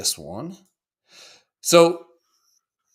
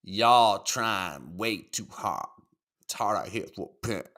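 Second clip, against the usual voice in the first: put-on very slow, drawn-out Southern accent; 4 semitones lower than usual. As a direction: stretch it out like a Southern drawl. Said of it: "that's the way he wrote it".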